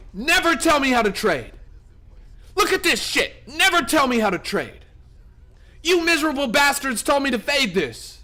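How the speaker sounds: gloating yell. Real, not acted. Streamer gloating at chat who told him to sell, loud. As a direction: rub it in chat's face. Gloating at chat's bad advice.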